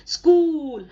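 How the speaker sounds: स्कूल with a long, stressed oo; स्कूल said loud with a held oo. Shown as a demonstration. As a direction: lean into the oo of स्कूल. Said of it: "स्कूल को हम बड़ा ऊ pronounce करते हैं".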